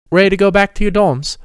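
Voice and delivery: cheerful offer; Peter cheerful. Acted. Peter asks.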